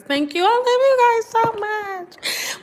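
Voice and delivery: high, slow, emotion-filled thanks; choked up. Real, not acted. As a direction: voice catching with real feeling. Moved by viewers' kind comments.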